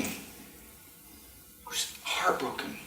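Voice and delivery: whispered, heartbroken; sad whisper. Acted. Narrating the boy's heartbreak in a whisper. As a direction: let it fall to a whisper.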